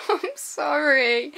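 loud, slow, laughing sorry; laughing apology. Real, not acted. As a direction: giggle through the apology. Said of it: "I'm not going to tease you anymore".

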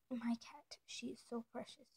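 soft hushed praise of the cat; tender whisper about the cat; whispered gushing. Real, not acted. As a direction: whisper it adoringly.

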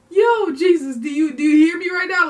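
loud, high call upward, a bit fast. Real, not acted. Vlogger calls out to Jesus mid-video, loud joyful call.

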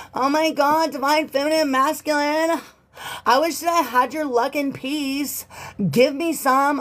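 high mocking whiny voice; envious ex. Reader mocks the envious ex in a high whiny voice. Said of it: "this person wishes they had your luck".

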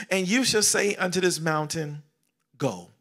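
full-voice scripture proclamation; preaching. Acted. Pastor proclaiming scripture loudly to the congregation. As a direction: declare it to the church.

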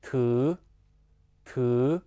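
"t" syllable drawn out with a rising tone; syllable demonstration. Shown as a demonstration. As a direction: model "t" slowly, gliding up, twice. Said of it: "这个词读作".

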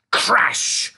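loud, high exclamation; abrupt dramatic burst; sudden crash. A narrator's reading. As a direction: explode on the word like an impact.

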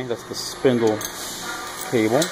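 ordinary talk

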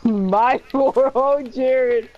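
loud, high laughing protest; playfully scandalized call; mock outrage. Real, not acted.

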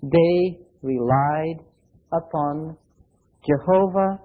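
very slow weighted phrase, pausing before "Jehovah"; emphasize relied. Shown as a demonstration. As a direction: stretch "they relied" and let "upon" fall away. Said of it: "another word that I really want to emphasize".

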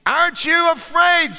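high, incredulous devil voice; mock Satan. Satan asking the old man, baffled. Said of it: "said Satan".

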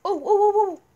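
high panicked oh oh oh; startled gasping cries. Real, not acted. Trapped in cell, panicking.

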